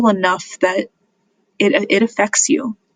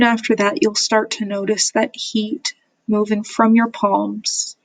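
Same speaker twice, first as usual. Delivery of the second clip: measured meditation guide with long pauses between phrases; guided meditation. Real, not acted. Therapist guiding listeners through self-compassion, calm meditation voice. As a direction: lead them gently, leaving silence after each phrase.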